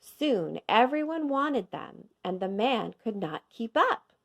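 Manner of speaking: ordinary delivery, nothing marked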